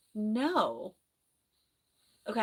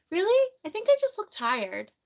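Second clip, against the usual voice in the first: surprised; 11 semitones higher, 7 dB louder than usual. Real, not acted. Reacts to comment "you look sad.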